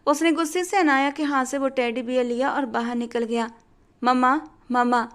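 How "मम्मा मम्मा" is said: a mild acted call for mom; shouting for mother. Angry son calling his mother out, mild mock shout. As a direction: call out for mama, only half raised. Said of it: "वो चिल्लाता हुआ".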